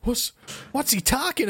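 put-on Los Angeles voice; playful attempt at an LA accent. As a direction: lightly imitate someone from LA. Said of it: "That's my L A voice".

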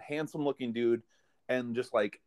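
normal talking voice

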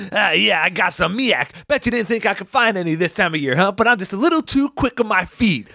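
high, cocky mock bragging; Ernest. Ernest tosses miak hand to hand, high cocky taunt. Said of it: "he's going".

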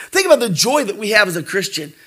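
ordinary delivery

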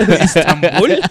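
high laughing question; laughing disbelief. Pretend. Laughing mock surprise.

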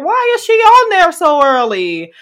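mock exasperated shout. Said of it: "And I was like".

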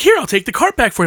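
high-pitched, eager imitation; helpful stranger. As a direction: play a man innocently offering help. Imitates helpful man at grocery store.

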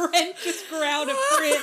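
words through loud laughter; laughing. Real, not acted. Laughing at dog's growl.

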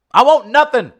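high, loud rallying cry; Dutton-style shout. Acted. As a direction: go up big like a locker-room speech. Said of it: "channel that part of Charles S. Dutton".

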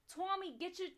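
put-on loud, high Staten Island mom calling her kid. Trying accents for a friend, Staten Island mom calling Tommy. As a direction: holler like a Staten Island mother. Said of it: "Staten Island mom".

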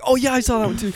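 playful high-pitched imitation of eager agreement; mock excited recognition. Mimicking a friend who saw the same TikTok, excited.

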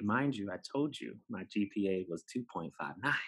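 ordinary delivery

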